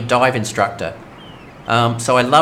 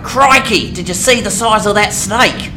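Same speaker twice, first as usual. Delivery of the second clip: broad Aussie exclamation, much higher and louder; hammed-up outback snake wrangler, pitched up and loud; Crocodile Hunter Aussie accent; 8 semitones higher, 5 dB louder than usual. Streamer showing the game how a real Aussie sounds, in a hammed-up, loud Aussie accent. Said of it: "He could have done a".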